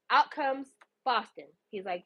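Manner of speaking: ordinary talk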